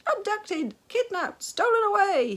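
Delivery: very high, shrill, loud screams; desperate scream. A narrator's reading. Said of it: "he screamed".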